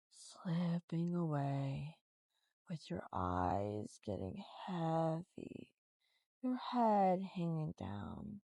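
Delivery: slow their thoughts down; hypnotic voice. Shown as a demonstration. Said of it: "switch back to my normal voice".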